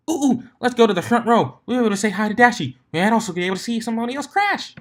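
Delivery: very high, loud and fast, bubbling over; squeaky high-pitched cartoon pony racing through the words; excited Pinkie. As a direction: bounce off the walls with glee.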